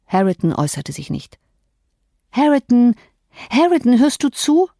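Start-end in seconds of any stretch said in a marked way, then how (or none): 2.3-4.7 louder, higher call rising into a question; insistent calling. Acted. Cathy calls her silent cousin, insistent. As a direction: demand his attention.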